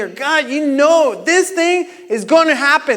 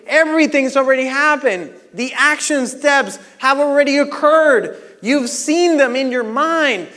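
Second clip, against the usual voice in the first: ordinary talk, nothing marked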